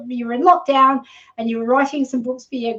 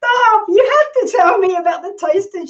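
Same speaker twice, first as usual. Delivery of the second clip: excited outburst; 8 semitones higher than usual. Real, not acted. Host interrupting her guest in delight, excited high cry. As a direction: break in, thrilled to hear more.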